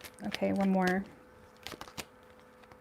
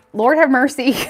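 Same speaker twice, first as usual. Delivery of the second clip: loud, raised cry with HAVE stressed; loud exclamation; 4 semitones higher, 9 dB louder than usual. Real, not acted. Seeing the Pisces cards, a loud disbelieving exclamation. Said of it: "Lord have mercy is right".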